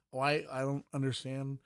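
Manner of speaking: ordinary talk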